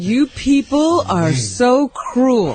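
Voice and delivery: slow, drawn-out mock reproach; retold scolding. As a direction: imitate her scolding, stretching every word. Retelling a colleague's scolding.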